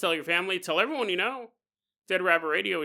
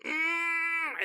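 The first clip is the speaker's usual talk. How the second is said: high, held pushing grunt; mock straining groan; 12 semitones higher than usual. Imitating a woman pushing in labor, mock straining groan. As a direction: squeeze out a long pretend labor groan.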